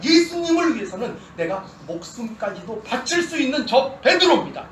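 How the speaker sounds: loud, high pleading as an eager disciple; Peter protesting. Pretend. Peter pleading with Jesus that he loves him, loud and earnest. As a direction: cry out your devotion, hurt and insistent, with a laugh.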